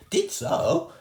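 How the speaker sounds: put-on very high little-kid retort; cheeky toddler voice; toddler insisting. A narrator's reading. Fudge contradicting Mum, high cheeky toddler voice.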